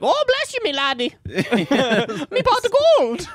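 very high squeaky mock leprechaun voice. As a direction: squeal it like a little cartoon leprechaun. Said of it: "I guess it could be like".

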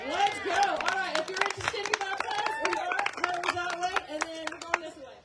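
loud, high rallying call to the crowd. Real, not acted. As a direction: rouse the congregation and point them the way. Calling the crowd to baptism.

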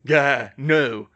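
loud, startled cry of pain; loud recoil from a sting; pained yelp. A narrator's reading. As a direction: yelp it out as if just hurt. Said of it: "Harry tried it and yelped in pain".

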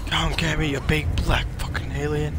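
loud taunt. Real, not acted. Gamer daring the alien to attack him, loud taunting call. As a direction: goad the monster into charging.

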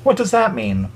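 put-on an eight-year-old asking innocently; young child's voice. A narrator's reading. Emily asks her moms what it means, child voice. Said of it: "asked Emily, who was eight".

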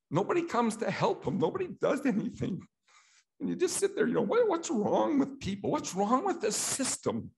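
real upset breaking into a high pitch; emotional. Real, not acted. Recalling the ignored old man in the ER, getting emotional and high. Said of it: "Oh, I'm gonna get emotional".

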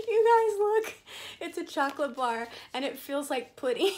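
cracking up at a melted chocolate bar; laughing hard. Real, not acted. Showing viewers a melted chocolate bar, laughing loudly. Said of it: "I'm dying. That's funny".

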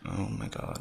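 usual speaking voice

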